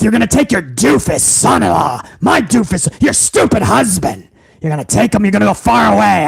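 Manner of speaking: hammed-up boss shouting at his son-in-law; Vince McMahon impression. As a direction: bark insults at the staff like a furious old tycoon. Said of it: "I will give you a little bit of Vince".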